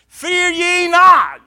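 slow, loud, high declaration of Fear ye not; slow booming Fear ye not; proclaimed scripture. Acted. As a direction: stretch each word out like a command from heaven.

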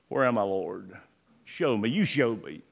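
soft, low, searching plea to God; humble murmured prayer; hushed prayer. Acted. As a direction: pray it quietly, as if alone with God. Honest whispered-style prayer to God.

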